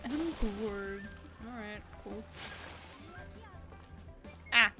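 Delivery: usual speaking voice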